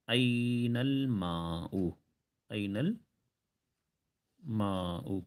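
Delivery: أين الماء drawn out very slowly, every sound spelled; slow model phrase. Shown as a demonstration. Teacher modelling Arabic for students, very slow and careful. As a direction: say it slowly so the class can copy. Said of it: "پانی والا word کیا ہے عربی میں".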